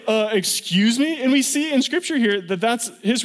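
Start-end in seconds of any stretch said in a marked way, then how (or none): 0.1-1.2 mock indignant Joseph. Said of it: "he's probably".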